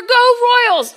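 shouted high and loud like a fan in the stands; sports cheer. Retold or imitated. Preacher mimics a stadium fan, loud cheer. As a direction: cheer it like the home team just scored.

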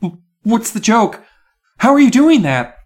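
high, loud disbelieving yell. A narrator's reading. Miss Carmen yelling at the microphone, very loud. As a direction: blast out the startled questions. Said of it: "Her voice was so loud".

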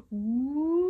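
long rising high wail; ghostly woo. Shown as a demonstration. Storyteller showing children the ghost's wail, rising woo. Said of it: "Can you go".

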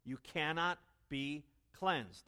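Jesus's claim, slow and emphatic; solemn emphatic. Retold or imitated. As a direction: weigh each word. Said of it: "Jesus is saying".

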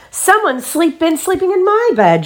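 very high, indignant mother bear; astonished storybook mother, high-voiced; Mommy Bear exclaiming. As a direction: exclaim it with pitch way up. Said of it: "Mommy Bear saw the jumbled pillows".

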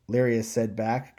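usual speaking voice

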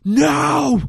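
high, loud drawn-out "no"; panicked mock cry. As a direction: cry out as if being dragged away, hammed up. Retold man being dragged away.

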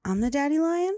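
put-on high, loud toddler question, rising at the end; small child asking. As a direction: imitate a child checking hopefully. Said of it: "a minute later, she's like".

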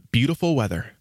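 usual speaking voice

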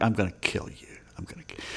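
hushed mock death threat that trails off; Shakespearean aside. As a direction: mutter a mock threat under your breath, like a stage aside. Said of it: "that was a Shakespearean aside".

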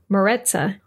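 Maritza with an American r, reduced first vowel; American accent. Retold or imitated. US parents and friends saying the name, American accent. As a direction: say Maritza like an American, muh-RET-suh. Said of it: "my friends from the United States pronounce".

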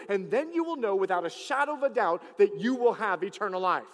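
loud, rising preacher's proclamation; full-force preaching. Acted. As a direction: preach it out to the whole church. Preaching to the congregation.

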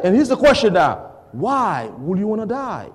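ordinary talk, nothing marked

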